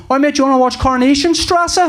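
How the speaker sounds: high, chirpy mock voice of his Netflix suggesting a German soap. His Netflix account, voiced as a cheerful mate, suggesting a German soap, high and chirpy. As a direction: pitch it up like a cheery telly asking a friend.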